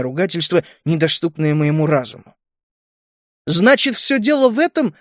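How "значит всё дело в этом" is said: high-pitched, accusing cry; raised-pitch indignant outcry without much volume; calling after him. A narrator's reading. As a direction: call it out at his back, pitched up. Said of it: "крикнула ему в спину Галия".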